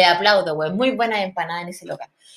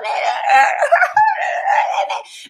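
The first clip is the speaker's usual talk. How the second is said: long high mock cackle; imitated laughing fit. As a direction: imitate laughter she can't contain. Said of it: "se reía". (imitando risa aguda incontenible)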